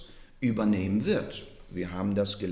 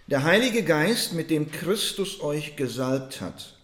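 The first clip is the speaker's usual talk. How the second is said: ringing out the verse high and loud; forceful scripture reading; 4 semitones higher, 5 dB louder than usual. Acted. Preacher proclaiming the verse to his congregation, loud and high.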